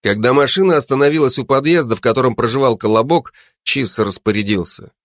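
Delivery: usual speaking voice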